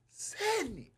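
high but soft name call; mock scream. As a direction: imitate a far-off scream without the volume. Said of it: "the headliner has screamed my name".

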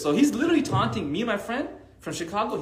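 ordinary talk

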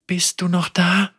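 trembling voice. A narrator's reading. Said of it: "mit zitternder Stimme".

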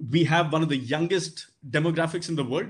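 normal talking voice

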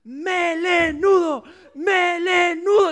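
very high, slow, loud mocking yell; monkeys yelling. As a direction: scream the taunt down at the lion. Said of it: "le gritaban los monos". (monos gritan con toda la fuerza al león)

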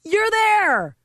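high, loud sarcastic cheer; mock congratulations. Trainer mockingly congratulating a scared contestant, high and loud. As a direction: celebrate her failure, hammed-up. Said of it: "And I was like".

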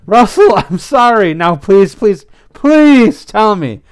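loud, high begging with a stretched please; pleading. A narrator's reading. Pepper rushes over begging for the news, pleading. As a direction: beg urgently, drawing out the last plea.

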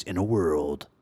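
low, deep trailer announcer; a hint of the In a World guy; movie trailer voice. Shown as a demonstration. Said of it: "That might be like the movies".

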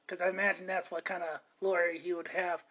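ordinary talk

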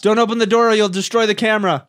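loud, high holler; shouted warning. Real, not acted. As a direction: call the warning across the house. Shouting warning toward the door.

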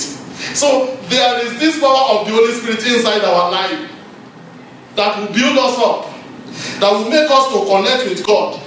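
high-pitched, insistent sermon delivery; urgent exhortation, pitch held high; impassioned preaching. Real, not acted. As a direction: drive each phrase home to the congregation. Preaches at raised intensity.

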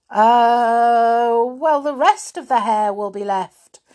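loud, high, long hesitation; stall, then blurt out the answer; stalling pupil. A narrator's reading. Binky stalling with prompted answer.

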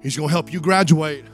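ordinary delivery

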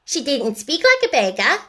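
put-on loud, high child's protest; crying out. A narrator's reading. Sister backing up sister, crying out. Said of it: "cried Nora".

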